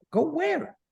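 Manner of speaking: high, disbelieving go where; incredulous question. Real, not acted. Teacher puzzling over God's order, incredulous.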